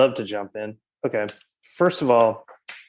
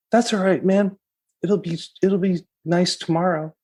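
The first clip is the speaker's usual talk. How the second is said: higher, easygoing reassuring voice; imitating a laid-back Kevin; 6 semitones higher than usual. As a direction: shrug off the rain like a chill friend. Friend retells Kevin's calm reply.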